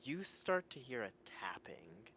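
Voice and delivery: hushed, unvoiced eerie telling; whispered narration. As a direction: whisper it like a ghost story. Game master narrates night scene.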